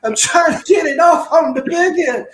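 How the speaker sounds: laughing loud insistence on the big end; emphatic loud repeat. Real, not acted. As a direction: punch it out to make the point. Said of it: "Not a little bit".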